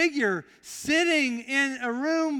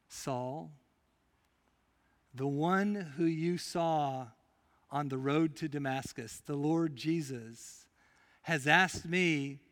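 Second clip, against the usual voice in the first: tender prayer; 7 semitones lower, 7 dB quieter than usual. Acted. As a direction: lay a hand on him and pray softly. Ananias prays over blind Saul.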